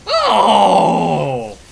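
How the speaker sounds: loud startled stammering and shouts; shocked yelps. Real, not acted. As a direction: sputter and yell at the shocking page. Reacting to shocking comic panel.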